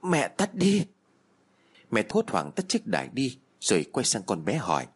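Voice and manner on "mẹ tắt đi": shout. Acted. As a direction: yell it to stop the chanting. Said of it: "con Nhi nó lại hét lớn".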